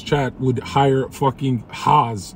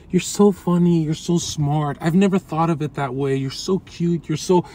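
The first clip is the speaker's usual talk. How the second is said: high-pitched mock fan flattery; imitating gushing admirers; 6 semitones higher than usual. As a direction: gush compliments in a put-on higher voice. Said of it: "someone would be like".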